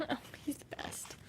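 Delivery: breathy whispered aside; whispering. Real, not acted. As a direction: whisper it fondly.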